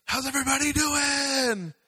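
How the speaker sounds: high, loud imitation of a hype-up shout; preacher's rousing greeting. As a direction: call out to a whole crowd, mimicking your friend. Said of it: "And he goes".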